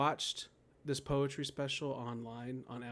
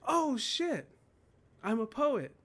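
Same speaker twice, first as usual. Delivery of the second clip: sudden realisation; 11 semitones higher, 6 dB louder, slower than usual. Retold or imitated.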